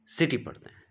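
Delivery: सिटी said high and crisp, soft s sound; teacher's model सिटी, high and clear. Shown as a demonstration. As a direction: raise the voice and say सिटी with an s. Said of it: "किटी पढ़ते हैं या सिटी".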